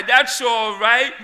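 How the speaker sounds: emphatic reassurance. Shown as a demonstration. Preacher loudly tells congregation it's fine. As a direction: declare it boldly to the crowd. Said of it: "Be anxious for nothing".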